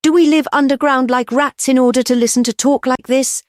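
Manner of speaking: high, loud rousing speech; fiery oration. A narrator's reading. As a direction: stir the crowd to anger. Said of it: "voice that made every man jump".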